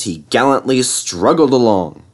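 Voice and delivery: ordinary delivery, nothing marked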